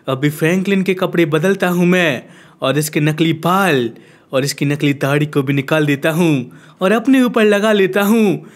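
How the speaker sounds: ordinary talk, nothing marked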